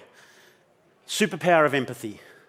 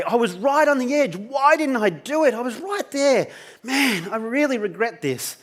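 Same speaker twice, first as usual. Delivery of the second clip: lightly voiced regret, higher; a mild imitation of a regretful voice; 7 semitones higher than usual. Instructor imitating Jan's future regret, mildly higher. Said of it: "you're gonna say".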